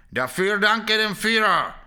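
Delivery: loud, high, slow mock German shout; mocking roar. A narrator's reading. Sergeant Krpata mocks the SS men in German, roaring. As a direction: roar it as a sneering parody.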